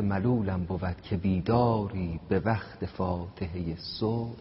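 slow, measured recitation of a Persian verse; poem recital. Acted. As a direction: draw each line out like a ghazal reading. Recites Hafez ghazal slowly.

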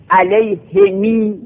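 علیهمی said slowly as 'alayhimī' with a long ee after the mim; Arabic 'alayhimī' read slowly; slow lengthened mim. Shown as a demonstration. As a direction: stretch علیهمی, kasra on ha and mim, final ee drawn out. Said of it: "کسرها کسر میم با اشباع".

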